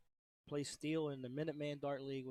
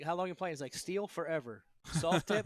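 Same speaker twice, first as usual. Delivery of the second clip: loud Boston accent impression; 4 semitones higher, 10 dB louder than usual. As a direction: boom it out Boston style. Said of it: "please tell me Dave speaks like it".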